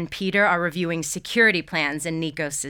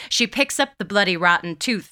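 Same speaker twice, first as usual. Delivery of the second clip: usual speaking voice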